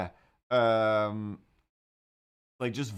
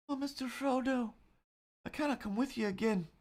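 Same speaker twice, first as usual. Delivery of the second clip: bad Samwise impression; 6 semitones higher than usual. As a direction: play a timid, devoted Sam pleading with Frodo, pitched way up. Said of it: "that's not a good impression".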